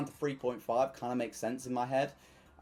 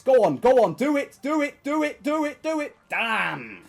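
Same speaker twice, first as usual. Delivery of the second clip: high, loud, rapid-fire repeated pleas; will it on at full voice; frantic urging; 12 semitones higher, 11 dB louder than usual. Real, not acted. Streamer urging the slot to drop, frantic shouting.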